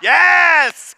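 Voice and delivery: high, loud, joyful yes; excited cheer. Real, not acted. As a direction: celebrate the crowd. Cheers after crowd shout.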